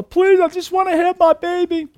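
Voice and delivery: high, desperate begging voice; pleading. Retold or imitated. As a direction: beg for your unborn child's life. Sharon Tate begging for her baby.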